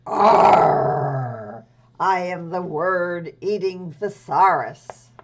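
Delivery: loud, slow growl of a monster book. Acted. Puppet thesaurus greets the class with a loud growl. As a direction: boom it out like a hungry creature. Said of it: "some other creatures who would like to say hello".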